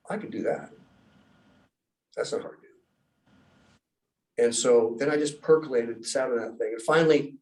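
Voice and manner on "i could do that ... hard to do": whispered thought. Retold or imitated. Quiet inner reply to the voice.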